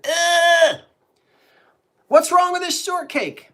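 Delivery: loud, very high mock revulsion; imitated disgusted outburst. Friend spits out a bite of shortcake, loud mock disgust. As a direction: squawk it like a friend spitting out food. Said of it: "spit it out and said".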